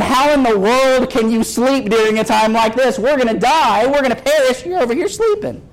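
high-pitched frantic protest, climbing to a squeak; acted panic. Disciples waking sleeping Jesus in the storm, acted panic. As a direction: plead in mock panic, pitch rising to the end. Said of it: "they begin panicking".

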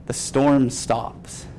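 ordinary talk

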